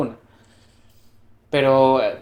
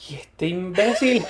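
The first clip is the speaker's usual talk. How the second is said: laughing indignation; 4 semitones higher, slower than usual. Retold or imitated. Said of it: "Y yo".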